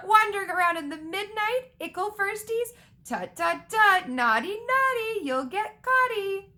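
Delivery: high cackling taunt with teasing lilt; cackle, then tease in a high, loud singsong. A narrator's reading. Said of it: "Peeves cackled".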